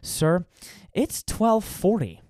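high, slow, deadpan; incredulous waitress. Retold or imitated. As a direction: spell out the obvious to him. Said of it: "she looks at me and says".

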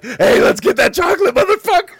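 high, loud crazed bird yell; Sonny the Cuckoo Bird. Acted. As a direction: shriek it like a manic cereal mascot. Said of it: "Whereas Sonny's like".

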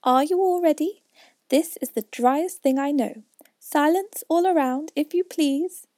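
high, loud self-important mouse; pompous mouse. A narrator's reading. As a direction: lecture the wet animals grandly. Mouse addressing the assembly.

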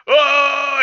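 high, loud mock cry; hammed-up drawn-out "oh"; acted death cry. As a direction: cry out as if being blasted away. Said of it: "he's like".

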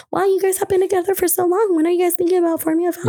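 mock the chirpy people who pry; nosy acquaintance. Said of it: "people come up to us and they ask us".

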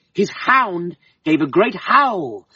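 plain reading voice

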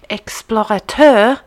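explorateur slowly, with a French "-teur"; attempted French pronunciation. Shown as a demonstration. Host unsure of her French, explorateur slow and drawn out. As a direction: have a careful go at French explorateur. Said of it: "not sure my pronunciation's great on that".

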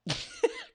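a short high-pitched giggle. Real, not acted. After running out of toasts, short high giggle. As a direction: giggle at yourself.

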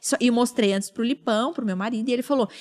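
usual speaking voice

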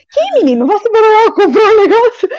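acted-out impatient telling-off; exasperated. Esposa manda marido comprar logo. (bronca exasperada, encenada)

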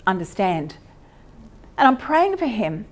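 usual speaking voice

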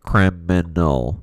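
"Criminal" spelled out very slowly, every syllable crisp; over-enunciate "Criminal" at a crawl; slow enunciated word. Acted. Dispatcher slowly enunciating "Criminal" for the caller. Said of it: "enunciate your words".

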